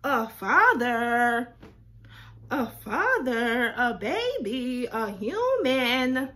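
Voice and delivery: loud, slow, each word hammered in shock; stunned disbelief. Real, not acted. Reeling at his Facebook post, loud and slow.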